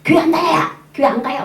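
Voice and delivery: high, loud mock outburst; retold angry yelling. Reluctant neighbor yells refusal at the churchgoer, imitated loud shouting. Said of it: "막 고함을 지르는 거야".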